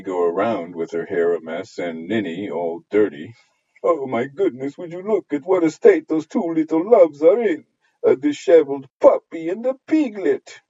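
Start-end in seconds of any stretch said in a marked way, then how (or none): 3.8-10.6 high, scandalised busybody voice; tutting neighbour. Acted. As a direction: cluck over the dirty children.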